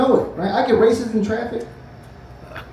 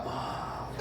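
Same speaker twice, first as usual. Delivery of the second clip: winded gasp; 18 dB quieter than usual. Retold or imitated. Getting punched in the stomach.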